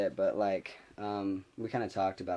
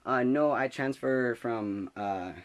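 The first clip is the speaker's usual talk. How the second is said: slow, raised mock Finnish accent; a hint of Finnish accent, higher and careful; imitated Finnish accent; 4 semitones higher, 6 dB louder, much slower than usual. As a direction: lightly imitate a Finnish girl's accented English, louder and drawn out. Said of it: "she has this beautiful accent".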